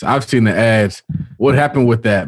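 ordinary delivery

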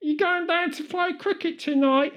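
high, put-on cartoon British accent, pitched well up; stock British accent. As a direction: lay the British caricature on thick. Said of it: "as our standard British accent".

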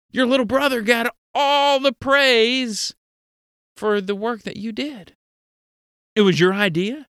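ordinary delivery

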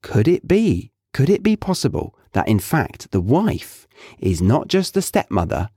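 usual speaking voice

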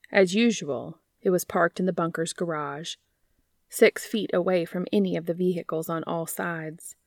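steady fanfic reading voice; story narration. A narrator's reading. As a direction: read the story to listeners. Reads fanfic aloud.

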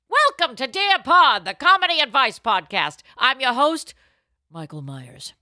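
loud, high show-host intro; announcer voice. Acted. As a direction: boom it out like a radio host. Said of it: "show intro announcer voice".

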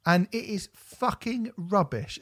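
slow, weighted words, fucking leaned on; emphatic verdict. Real, not acted. Host gives a blunt verdict on the album. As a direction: drag it out with disgust. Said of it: "emphatic verdict".

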